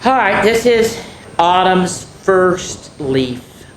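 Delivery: ordinary delivery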